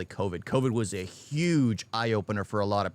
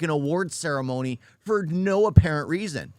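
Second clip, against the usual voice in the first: usual speaking voice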